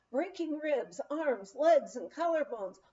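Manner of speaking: plain reading voice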